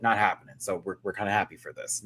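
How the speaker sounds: ordinary delivery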